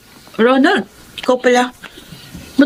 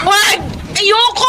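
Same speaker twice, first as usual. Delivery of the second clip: higher, desperate cries of no; frightened refusal; 5 semitones higher, much slower than usual. Acted. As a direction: refuse him in rising panic. Resisting the assault. (takot na pagtanggi)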